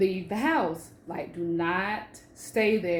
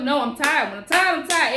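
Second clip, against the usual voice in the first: raised, emphatic insistence; insistent; 6 semitones higher, 7 dB louder than usual. Retold or imitated. Insisting to her fiance she's too tired, raised voice. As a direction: lean hard on being tired.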